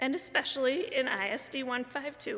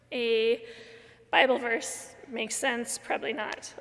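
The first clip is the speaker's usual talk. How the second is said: normal talking voice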